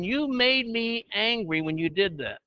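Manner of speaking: slow, pointed mock accusation; a deliberate, blaming "you made me angry"; accusing quote. Shown as a demonstration. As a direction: play the blaming spouse. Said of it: "Instead of saying".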